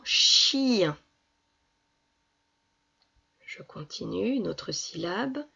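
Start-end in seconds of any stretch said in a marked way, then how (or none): 0.0-1.0 "chien" slowly, long i gliding into nasal un; drawn-out syllable. Shown as a demonstration. Teacher giving an example word for ien, drawn out. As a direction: stretch the i inside "chien". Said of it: "Comme dans".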